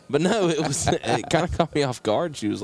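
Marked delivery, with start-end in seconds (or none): none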